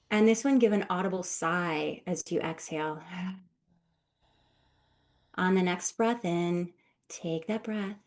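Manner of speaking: soft voice of a breathing coach; guided meditation. Acted. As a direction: ease them into each breath, calmly. Leads a guided breathing exercise.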